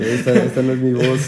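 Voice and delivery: sensual voice. Pretend. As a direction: play a femme fatale, half laughing. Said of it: "Ahorita anda con voz sensual".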